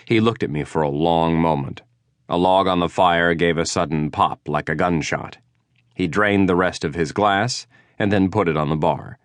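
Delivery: usual speaking voice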